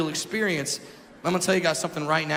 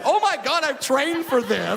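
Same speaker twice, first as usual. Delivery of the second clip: giddy excitement; 7 semitones higher than usual. Retold or imitated. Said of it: "I'm like".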